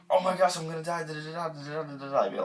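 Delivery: gasp, then high, loud panicked babble running into nonsense; hammed-up panic dissolving into da-da-da; retold panic. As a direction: act out panic with slurred, tumbling syllables. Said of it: "I immediately was in panic mode".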